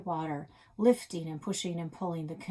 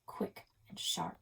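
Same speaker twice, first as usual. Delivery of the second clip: whisper; 7 dB quieter than usual. A narrator's reading. Whispered narration.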